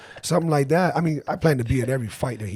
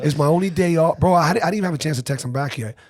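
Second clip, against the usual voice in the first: louder whiny complaining voice; 5 semitones higher, 5 dB louder than usual. Retold or imitated. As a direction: whine it, put-upon and loud. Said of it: "whiny little voice".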